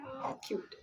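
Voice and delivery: soft unvoiced whisper; whispered cute; whispered aww. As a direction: breathe it out fondly. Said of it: "We're like".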